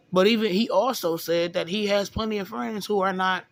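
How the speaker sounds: ordinary talk, nothing marked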